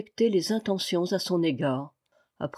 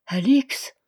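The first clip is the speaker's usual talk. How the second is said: stunned disbelief; 5 semitones higher, 5 dB louder than usual. A narrator's reading. Learns his daughter intervened.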